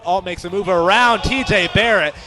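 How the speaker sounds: loud, rising roar of a goal call; excited goal call. Real, not acted. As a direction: let it rip as the ball hits the net. Goal call in soccer commentary.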